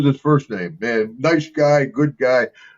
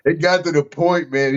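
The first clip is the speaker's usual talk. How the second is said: normal talking voice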